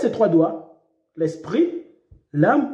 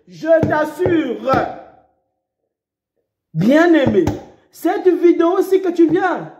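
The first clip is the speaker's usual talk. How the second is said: fiery preaching; 6 semitones higher than usual. Acted. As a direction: preach it hard at the listener. Preacher exhorts the viewer.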